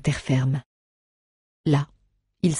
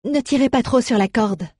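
a lightly voiced raised order to the crew; firm order; 7 semitones higher than usual. A narrator's reading. Kathleen ordering the others on the raft, firmly. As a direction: tell them, a touch commanding. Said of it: "ordonna Kathleen".

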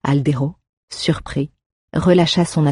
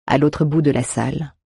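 plain reading voice

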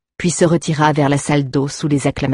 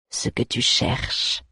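slow, softer menacing whisper; 3 semitones lower, 7 dB quieter, slower than usual. A narrator's reading. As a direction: breathe the words slowly. Master lists warrior's thoughts.

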